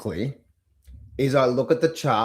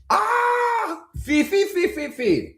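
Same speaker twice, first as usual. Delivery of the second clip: high cry of mock panic, fading down; fearful trader, hammed-up wail; much slower than usual. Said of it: "in that emotional state".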